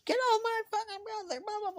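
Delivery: high imitated shriek of the sister; sister screaming. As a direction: screech it like she's defending her brother.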